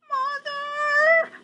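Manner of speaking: put-on very high thin squeak of a lost baby bat. Lost baby bat calling for her mother, high squeak. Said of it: "Stella Luna squeaked".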